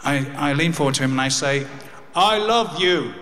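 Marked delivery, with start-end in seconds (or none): 2.2-3.1 tender goodbye. Retold or imitated. Son to his dying father, gentle and sincere. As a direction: say it gently to your father one last time.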